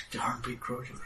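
hushed, voiceless gossip; crowd whisper. Acted. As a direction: whisper it like an awed onlooker. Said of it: "hear the whispers like".

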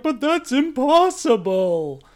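loud, high, drawn-out mock shock; mock disbelief. Podcaster parodying the crew's reaction to the reveal, mock disbelief. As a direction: cry it out like an overacting crew member.

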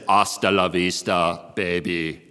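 put-on low, understated Austrian accent; low-key Austrian movie-hero impression; Arnold Schwarzenegger. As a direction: quote it deep, like an Austrian action star. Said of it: "where he says".

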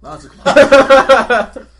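a hammed-up booming repeat; slow, drawn-out loud echo. Player echoing the GM with a slow, booming repeat. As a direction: stretch the words out long and loud. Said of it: "player echoes GM's line".

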